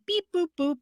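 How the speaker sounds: high mock robot beeping; robot beeps. Imitating a boy in a theater restroom making high robot beeps. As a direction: chirp it like a kid's toy robot. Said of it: "was making little robot noises and he was like".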